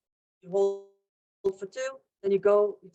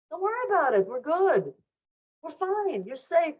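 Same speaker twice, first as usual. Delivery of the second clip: lightly acted, higher-pitched brush-off; mock dismissive reassurance; 6 semitones higher than usual. Parents to scared kids in the shelter, breezily reassuring. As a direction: wave the worry away, lilting.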